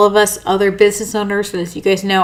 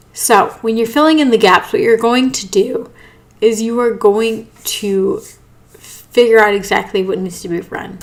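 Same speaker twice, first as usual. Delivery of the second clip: ordinary delivery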